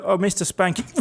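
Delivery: normal talking voice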